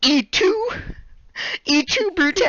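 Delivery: imitating brother as betrayed Caesar. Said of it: "he was Julius Caesar".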